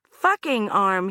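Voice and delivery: "Fucking arm" slow and pointed, stress on "Fucking"; slow correction. Acted. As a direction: correct him word by word. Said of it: "Anna corrected".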